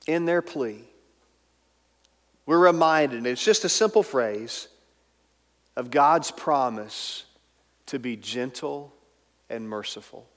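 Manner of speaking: normal talking voice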